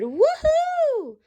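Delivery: a high, joyful whoop; crowd whoop. Acted. The cheering crowd whoops for helping the stranger, high and gleeful. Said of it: "shouted and cheered and whooped and hollered".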